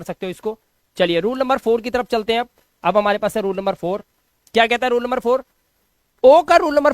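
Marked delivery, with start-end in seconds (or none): none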